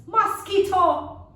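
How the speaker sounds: high, loud, slow cry of mosquito; thrilled spotting; excited discovery. Acted. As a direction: pounce on the word in triumph. Lizard spots mosquito.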